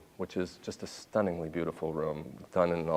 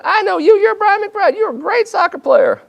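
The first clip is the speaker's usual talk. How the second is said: high, booming gushing fan; a drunk fan's loud delight; loud excited fan; 16 dB louder than usual. Retold or imitated. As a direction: gush at him at the top of your voice. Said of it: "he said".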